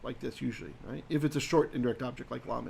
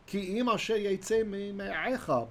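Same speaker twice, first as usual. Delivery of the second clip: intoned Hebrew phrase; 5 semitones higher, slower than usual. Shown as a demonstration. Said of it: "complex in the Hebrew there".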